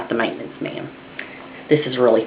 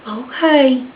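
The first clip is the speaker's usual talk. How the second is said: timid okay; 8 semitones higher than usual. Retold or imitated. Scared child agreeing.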